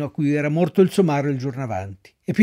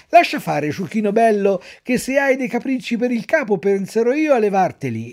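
louder, menacing and mocking; angry threat; 6 semitones higher, 3 dB louder than usual. A narrator's reading. The master threatening the donkey with the whip, angry. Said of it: "gridò il padrone imbizzito".

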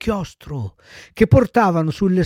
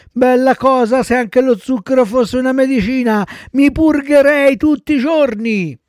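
put-on high, loud little-boy voice; gleeful child puppet; 9 semitones higher, 5 dB louder than usual. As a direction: enthuse like a greedy puppet boy. Voices Pinocchio delighted with sugar. (burattino entusiasta, voce acuta)

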